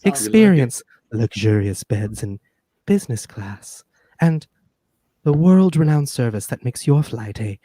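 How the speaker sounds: slow, sultry ad read; sexy woman voice. Pretend. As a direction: purr the ad like a seductive woman. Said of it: "if I do the ad read like a sexy woman?".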